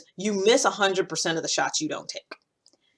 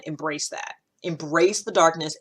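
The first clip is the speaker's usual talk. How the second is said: ordinary delivery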